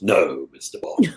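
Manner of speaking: slow, low menacing villain; Bond villain voice. Shown as a demonstration. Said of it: "I love the villain voice".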